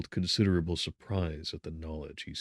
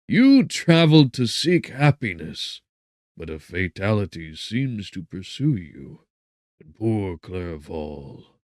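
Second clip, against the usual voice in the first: mournful; 2 semitones higher, 10 dB louder, slower than usual. A narrator's reading. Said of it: "looking mournfully".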